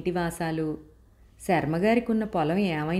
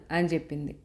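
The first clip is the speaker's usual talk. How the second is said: ordinary delivery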